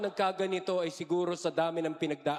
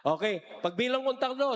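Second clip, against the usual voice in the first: usual speaking voice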